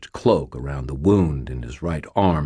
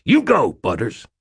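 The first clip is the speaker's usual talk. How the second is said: cheering; 6 semitones higher, 4 dB louder than usual. Acted. Cheering on friend.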